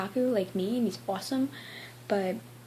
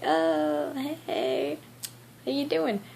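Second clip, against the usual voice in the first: higher, overly casual greeting; playing innocent; 5 semitones higher, much slower than usual. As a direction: act like nothing's going on. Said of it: "my whole game plan".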